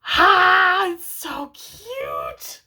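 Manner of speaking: overwhelmed by the cuteness, swooning. Real, not acted. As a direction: gush like it's adorable. Swooning over romance.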